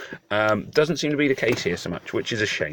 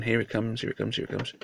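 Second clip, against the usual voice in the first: softer, building suspense; hushed anticipation; 8 dB quieter than usual. Acted. Gamer hushing as the bird falls, softer. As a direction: murmur it, waiting for the drop.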